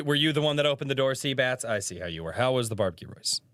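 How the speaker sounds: normal talking voice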